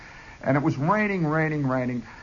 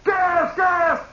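yelling the alarm, pitch way up; shouting gas; 14 semitones higher than usual. Retold or imitated. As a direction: bark out the gas warning like a drill officer.

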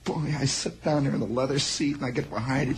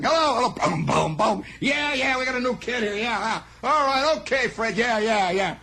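boss on the phone; 7 semitones higher than usual. Retold or imitated. Mister Moss shouting into the bad phone line, high hurried voice.

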